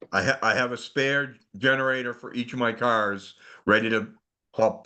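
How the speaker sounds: ordinary talk, nothing marked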